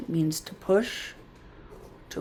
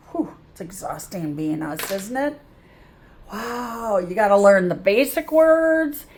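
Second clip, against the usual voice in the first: ordinary talk, nothing marked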